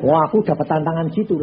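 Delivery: ordinary talk, nothing marked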